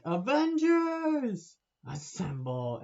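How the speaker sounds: very high, loud, slow 'Avengers', then dropped 'assemble'; mock battle call. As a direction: belt it like a hammed-up movie rally cry. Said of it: "they're all like".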